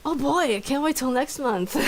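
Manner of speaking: loud, high mock enthusiasm; sarcastic excitement. Friend hearing it happens every month, sarcastically excited. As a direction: overplay how thrilled you are. Mock eagerness for next gross episode.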